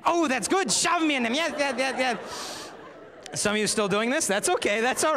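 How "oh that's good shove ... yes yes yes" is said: put-on much higher, louder girly squeal; squealing schoolgirl. Girl thrilled by her friend's shove plan, squealing. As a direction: shriek with delight at being shoved. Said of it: "the other girl would be like".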